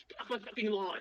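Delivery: a hint of a grumpy British crab; clipped cockney crab growl at trespassers. A narrator's reading. Crab telling Abigail off in a British accent. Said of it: "It's a British crab".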